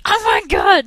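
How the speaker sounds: shocked exclamation. Retold or imitated. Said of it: "and I'm like".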